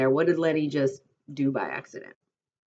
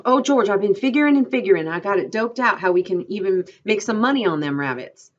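higher, louder eager outburst; roused, eager; 6 semitones higher, 8 dB louder than usual. A narrator's reading. Candy tells George about the rabbit money, excited. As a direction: pour out the plan with excitement. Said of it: "Now Candy aroused himself".

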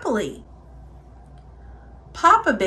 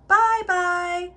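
higher, middle-sized bear farewell; 6 semitones higher than usual. A narrator's reading. Middle bear calling goodbye, middle-sized voice. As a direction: call it lightly in a raised voice. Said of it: "a middle-sized voice".

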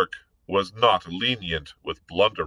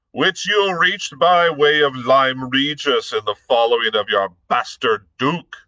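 higher, louder contemptuous sneer; 6 semitones higher, 4 dB louder than usual. Acted. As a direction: drip contempt on every word. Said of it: "The captain sneered".

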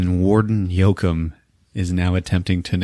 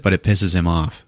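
normal talking voice

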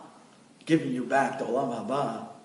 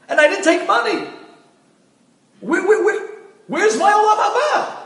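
much higher and louder, pleading in panic; dead husband screaming at the heavenly court, frantic; desperate screaming; 10 semitones higher, 7 dB louder than usual. Acted. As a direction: plead for your life, voice cracking up high. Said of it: "started screaming to the Bedin".

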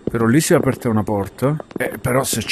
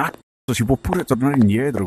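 ordinary talk

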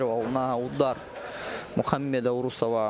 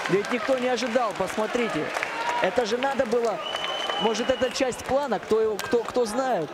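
excited; 6 semitones higher than usual. Real, not acted. Commentator still stunned after knockout.

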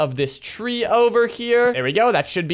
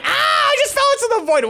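much higher panicked cry as he falls; startled yelp. Real, not acted. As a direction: yelp in shock, then blurt the rest. Falling into the void.

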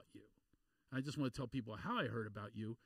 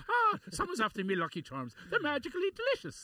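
hammed-up Irish leprechaun accent, higher and louder; cartoon Irish cereal mascot voice, pitched up; 14 semitones higher, 7 dB louder than usual. Host hams up an Irish accent for his Irish guest. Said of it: "That's the only way I can do an impression of an Irish person".

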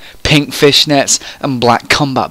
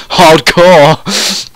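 amused remark through laughter; laughing; 5 semitones higher, 5 dB louder than usual. Real, not acted. Reader mocking the pyjamas line, laughing. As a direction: laugh as you say it, higher.

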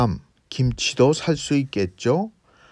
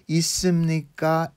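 ordinary talk, nothing marked

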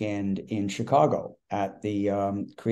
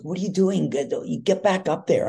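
higher-pitched imitation of an urgent manager; urgent retold demand; 6 semitones higher than usual. Manager comes running at the host backstage, urgent mock demand. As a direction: voice the manager pressing him, higher.

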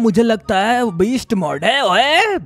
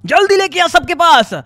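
loud, high, repetitive yelling; frantic callout; 7 semitones higher, 4 dB louder than usual. Real, not acted. Player spotting the slasher and calling teammate over, frantic callout. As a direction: shout excitedly to alert the team. Spots the enemy, calls team.

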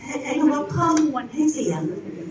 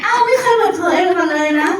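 mock surprise; 5 semitones higher, 6 dB louder than usual. Real, not acted. Playful mock surprise at student.

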